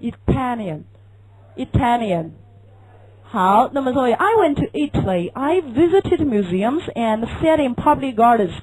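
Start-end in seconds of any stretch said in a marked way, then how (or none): 0.0-2.3 slow Italian, heaviest on TAL; i-TAL-ian, said slowly with a weighty middle; stress on second syllable. Shown as a demonstration. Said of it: "这个字音是最重".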